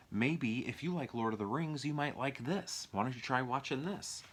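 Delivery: lightly mock a movie cover's sales pitch; coaxing sales pitch. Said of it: "They're really trying to be like".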